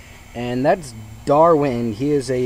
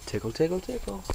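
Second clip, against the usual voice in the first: higher, softer parrot imitation; 8 semitones higher, 7 dB quieter than usual. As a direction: mimic the bird saying tickle. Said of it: "That's what Teddy says".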